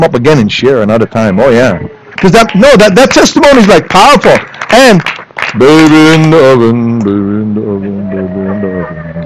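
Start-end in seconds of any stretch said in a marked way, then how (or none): 5.6-9.2 playful fading refrain. Pretend. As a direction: repeat it like an echo dying out. Joking hint, repeated and trailing away.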